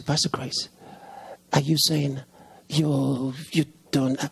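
low, halting imitation of a doubter; hesitant questioning. Church member questioning the pastor's claim, hesitant. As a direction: imitate someone faltering over a question, low.

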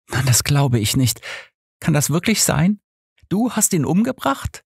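the pastor realising Gina killed him; entsetzt. A narrator's reading. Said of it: "sagte unser Pastor entsetzt". (immer höher steigendes Entsetzen)

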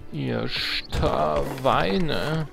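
ordinary delivery

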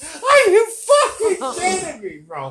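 high squeal of disbelief through laughter; can't believe it, squealing; shrieking with laughter; laughing disbelief. Real, not acted. Reacting to her eating in sleep.

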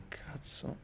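muttered under the breath; whispered curse. Real, not acted. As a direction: hiss it to yourself. Annoyed by echo, under breath.

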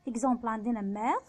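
ordinary delivery

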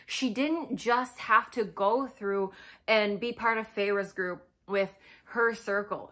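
ordinary talk

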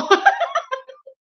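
high burst of real laughter; squealing laugh. Real, not acted. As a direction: crack up in a sudden shrill giggle. Laughs at her own big eyes.

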